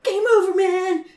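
high, loud mock panic; freaking out over the monster; panicked. Panicking at the scary scene.

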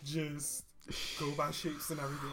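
calm teacher. Retold or imitated. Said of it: "He'd just be like".

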